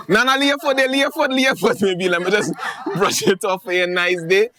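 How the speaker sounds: high, loud laughing coax, lay your foot; sly boyfriend coaxing. Acted. Boyfriend coaxing girlfriend to lay her foot, high laughing.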